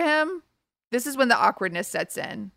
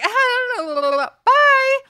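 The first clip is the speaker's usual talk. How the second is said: much higher and louder, a panicked squeak; squeaky awkward goodbye; 7 dB louder than usual. Retold or imitated. To the guy while running out the door, squeaky high panic. Escaping the guy's apartment.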